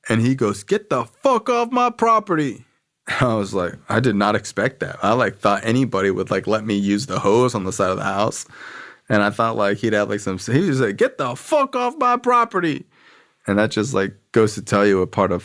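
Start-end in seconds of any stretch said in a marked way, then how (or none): 0.6-2.6 high, hammed-up voice of a hostile homeowner; much higher put-on voice of a property owner; mocking the homeowner. Homeowner chasing him off. 11.0-12.8 high, hammed-up voice of a hostile homeowner; much higher put-on voice of a property owner; mocking the homeowner. Homeowner chasing him off.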